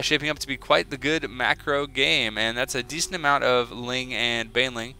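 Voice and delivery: ordinary talk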